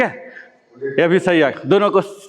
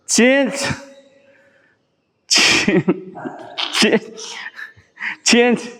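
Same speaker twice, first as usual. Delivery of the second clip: rising, higher, pepped-up repeated calls; quick rapid-fire drill calls climbing in pitch; energizing calls; 5 semitones higher, much slower than usual. Shown as a demonstration. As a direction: pump up the class with each call. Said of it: "मैं जल्दी जल्दी बोल रहा हूँ जोश दिलाने के लिए".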